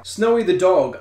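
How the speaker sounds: ordinary delivery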